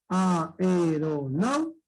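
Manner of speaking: aeronau very slowly, each vowel held apart; split into syllables. Shown as a demonstration. Teacher points out the diphthong, slow separated syllables. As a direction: stretch out A-E-RO-NAU. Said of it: "Dónde está la mayor fuerza de voz".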